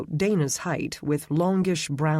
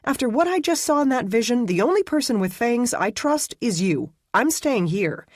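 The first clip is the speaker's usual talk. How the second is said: fast, higher, pleading and insistent; insistent frightened woman; 8 semitones higher, 4 dB louder than usual. Acted. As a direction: dig in, scared but stubborn.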